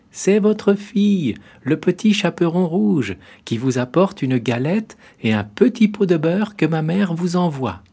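put-on high, loud girlish voice; the girl announcing herself sweetly; little girl. A narrator's reading. Girl answers at the door.

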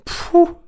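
a very high, loud mocking pouh; wolf's scoff. A narrator's reading. The wolf scoffs at the piglet's refusal, loud pouh. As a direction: scoff at the little pig. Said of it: "fit le grand méchant loup".

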